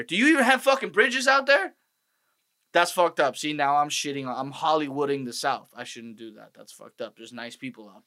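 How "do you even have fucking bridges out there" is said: high-pitched incredulous question; mock disbelief. Comedian riffing on Southern metaphors, high mock disbelief. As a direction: pitch it way up, as if baffled.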